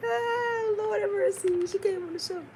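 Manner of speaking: high, laughing exclamation; real laughing disbelief, high-pitched; amused laughter. Real, not acted. Laughing reaction to retold claim.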